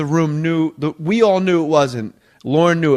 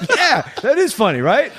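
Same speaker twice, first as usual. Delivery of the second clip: much higher, seeking-approval mock host; clueless host; 8 semitones higher than usual. Writer mocks the host fishing for agreement, high and unsure. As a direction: look around for agreement, pitched way up. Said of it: "the host is looking around like".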